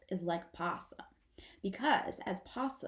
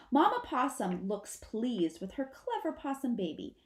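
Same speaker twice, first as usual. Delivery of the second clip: usual speaking voice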